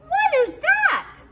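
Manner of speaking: high, loud pretend alarm; mock fright. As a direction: gasp it out, pretend scared. Pretend fright at scary pumpkin.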